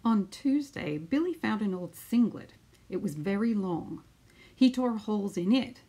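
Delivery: put-on lively storytelling voice for children; picture book read-aloud. Teacher reading a picture book to her class, lively storytelling. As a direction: read it out like story time on the mat.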